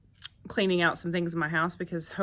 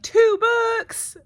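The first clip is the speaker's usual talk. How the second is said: much higher and louder, squealing delight; excited kids; 9 dB louder than usual. Retold or imitated. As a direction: squeal it like thrilled children. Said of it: "They were like".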